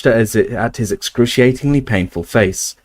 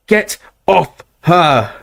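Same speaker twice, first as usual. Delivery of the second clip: slow, higher shout; 7 semitones higher, 3 dB louder, much slower than usual. Acted. Ron shouting at the snatchers to get off Hermione. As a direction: shout to protect her. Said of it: "Ron shouted".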